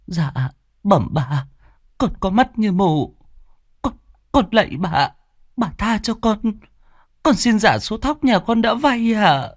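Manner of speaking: high, humble surrender; resigned plea. A narrator's reading. Servant hands over the paper, resigned and pleading. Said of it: "đành ngậm ngùi".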